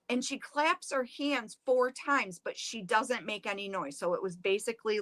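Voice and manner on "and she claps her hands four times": usual speaking voice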